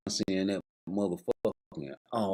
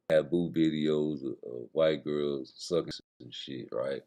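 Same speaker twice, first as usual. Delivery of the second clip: deep musing voice; 8 semitones lower than usual. Acted. Comedian musing about the tape in a low voice.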